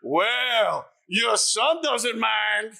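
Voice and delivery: loud, slow, high hammy bellow; hammed-up impression of the offended star; Al Pacino. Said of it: "And he just goes".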